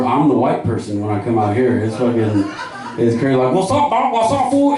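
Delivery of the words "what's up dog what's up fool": higher, singsong greeting; high local slang voice. Retold or imitated. Comedian mimicking local guys' greeting, high and singsong.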